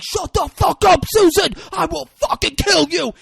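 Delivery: loud, high mock screaming threat; hammed-up full-voiced rage; acted furious yelling. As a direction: yell it like a hammed-up enraged boyfriend. Acting out a couple's violent quarrel.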